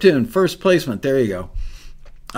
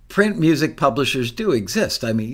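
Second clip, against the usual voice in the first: ordinary talk, nothing marked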